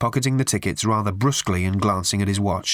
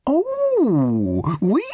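very high, slow, sugary raffle-lady voice; a prim, simpering old charity lady pushing tickets; high twittering church-fete lady; raffle woman; 9 semitones higher, much slower than usual. Acted. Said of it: "said the woman".

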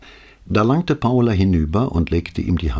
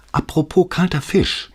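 put-on young girl voice; 12 semitones higher than usual. Daughter making a joke.

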